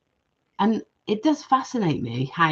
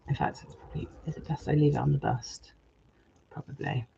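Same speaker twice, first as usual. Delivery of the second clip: hushed, half-whispered thinking aloud; soft whisper while deciding on the bust; whispered aside; 3 semitones lower, 10 dB quieter than usual. Real, not acted. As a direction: drop to a whisper, talking to herself. Muttering aside to herself.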